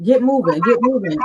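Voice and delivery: loud, high barked command; drill sergeant order. Retold or imitated. Sergeant urging the stalled recruit on, loud and high. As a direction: push her forward with a shouted order.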